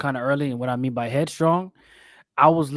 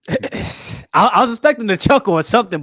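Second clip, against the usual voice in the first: laughing; 9 semitones higher, 7 dB louder than usual. Real, not acted. Laughing after a joke falls flat.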